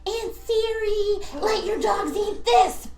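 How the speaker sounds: slow, drawn-out call to his mistress; mock slow command. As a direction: drag the words out. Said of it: "he was like".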